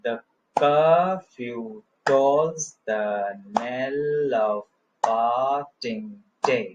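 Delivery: very slow scansion with CUR, TOLLS, KNELL, PAR and DAY drawn out on the beat; beaten-out iambic stress. Shown as a demonstration. Teacher beats out Gray's Elegy for students, very slow stressed scansion. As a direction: stretch each stressed syllable of "curfew tolls the knell of parting day" to a steady beat. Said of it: "जब भी stressed foot आएगा मैं एक थाप मारूंगा".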